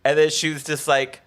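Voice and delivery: ordinary talk, nothing marked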